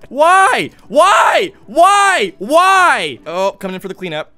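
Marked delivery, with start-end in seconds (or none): 0.1-3.2 loud, high repeated wail; anguished shouting. Real, not acted. Player protesting an attack, loud anguished wail. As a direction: cry out in protest each time.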